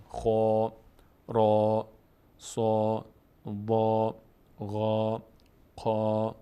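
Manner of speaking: drawn-out syllables with a full, rounded long A; full heavy vowels. Shown as a demonstration. Teacher showing students the full letters, full heavy vowels. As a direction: fill the mouth on each long A, one syllable at a time.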